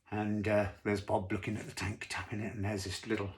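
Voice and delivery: ordinary delivery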